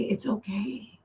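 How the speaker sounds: whispered soothing to yourself; soft whisper. Shown as a demonstration. Telling yourself it is fine, softly whispered. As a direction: hush it gently like comforting a friend. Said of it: "that you say".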